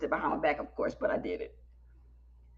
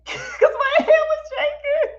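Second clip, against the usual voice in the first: high, loud words through real laughter; laughing; 4 dB louder, slower than usual. Real, not acted. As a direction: crack up while explaining. Cracks up at own story.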